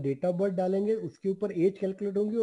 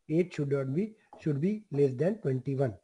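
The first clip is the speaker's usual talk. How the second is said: slow dictation; 2 semitones lower, much slower than usual. Shown as a demonstration. Dictating rule text.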